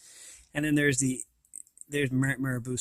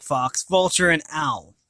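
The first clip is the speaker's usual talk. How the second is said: usual speaking voice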